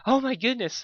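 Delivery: high squeaky gasp; squeaked. A narrator's reading. Fluttershy blushing at Spike's reply, a high squeak. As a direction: squeak it out in embarrassment. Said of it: "Fluttershy squeaked".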